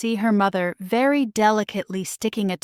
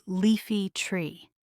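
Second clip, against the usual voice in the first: ordinary delivery, nothing marked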